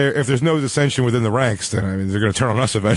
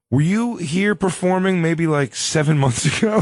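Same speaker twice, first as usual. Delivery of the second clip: imitated probing fan; 6 semitones higher than usual. Imitating a fan quizzing the comedian.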